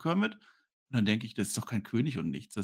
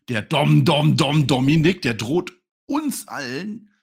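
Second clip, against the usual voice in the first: loud chanted name, then drawn out; mock announcer; 5 semitones higher, 10 dB louder, much slower than usual. Host announces the wrestler's threat, loud hammed-up proclamation.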